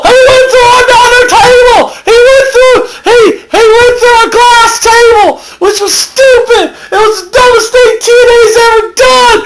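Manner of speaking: high screaming laughing play-by-play; hysterical wrestling commentator. Acted. A fan shrieks about a glass table, laughing. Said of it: "I'm so off the subject".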